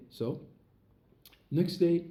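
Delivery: usual speaking voice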